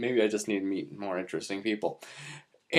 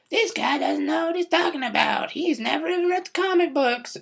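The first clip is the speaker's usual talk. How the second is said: high mocking whiny critic voice; mock nerdy critic. Vlogger mocking a comic-book fan critic, high whiny voice. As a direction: squeak out the critic's complaint. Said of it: "people out there who's like".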